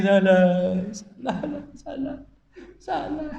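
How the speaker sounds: high, small mock-tearful wail; imitated weeping. Preacher imitates Abu Bakar joining the crying, mock weeping. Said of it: "Abu Bakar ikut nangis".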